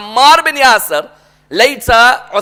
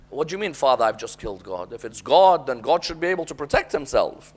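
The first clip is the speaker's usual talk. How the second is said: soft, lower, hushed questioning of his father; quiet challenge; 8 semitones lower, 10 dB quieter than usual. Retold or imitated. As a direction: question him under your breath.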